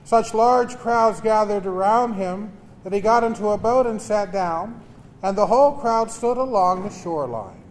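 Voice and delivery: solemn liturgical reading; Gospel reading. Acted. Proclaims the Gospel at Mass.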